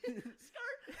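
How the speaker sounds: high squealed skid through laughter; laughing tyre screech. Pretend. Echoing co-host's Segway joke, laughing screech. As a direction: screech it while cracking up.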